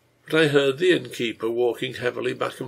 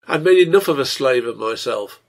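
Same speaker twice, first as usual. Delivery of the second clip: loud, agitated self-defence; excited; 8 dB louder than usual. A narrator's reading. Innkeeper defending himself to his wife, excited. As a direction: protest with raised voice. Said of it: "Slade said excitedly".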